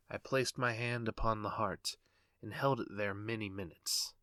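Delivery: usual speaking voice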